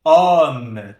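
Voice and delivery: HOMME with O overdone, wide open, held long and loud; too-open, drawn-out O in homme; exaggerated open O. Shown as a demonstration. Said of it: "não chega a ser um".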